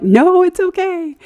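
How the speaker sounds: re-enacted emphatic "don't worry"; retold eager reassurance. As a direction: sing out the reassurance, stretching it. Retold reassurance to hesitant stranger.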